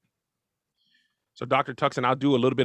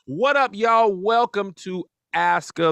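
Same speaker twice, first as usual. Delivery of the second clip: show host intro; 5 semitones higher, slower than usual. Acted. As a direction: greet the viewers like a show host kicking off. Opening his show's intro.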